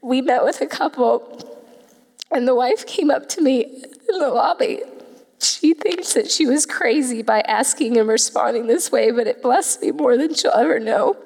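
choked up, high voice close to tears; real emotional. Real, not acted. Speaker telling church about the baby shower offer, emotional. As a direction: fight back tears while speaking, pitch climbing. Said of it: "I'm gonna get emotional again".

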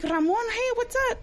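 high, bright happy greeting; excited greeting. Retold or imitated. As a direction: greet an old friend with delight. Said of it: "And I'm like".

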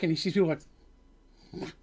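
ordinary talk, nothing marked

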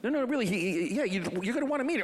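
high, pushy phone voice; voicing Art insisting. Art insists to Jim on the phone, high-pitched.

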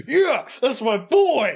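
high, drawn-out proud cheer; re-enacted fan's triumphant whoop; retold excitement. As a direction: cheer on your hero, stretching it out. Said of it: "how excited I got".

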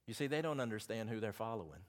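usual speaking voice

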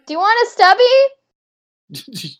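high, loud, slow Aussie offer; drawn-out ocker Australian accent. Acted. Offering a beer by the lake in Australian accent.

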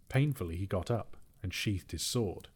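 plain reading voice

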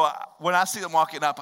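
usual speaking voice

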